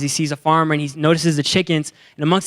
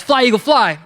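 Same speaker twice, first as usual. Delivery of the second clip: high, louder call to take off; acted urging cry; 7 semitones higher, 4 dB louder than usual. Young man tossing an eagle skyward, urging cry. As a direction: send the bird off with a raised voice.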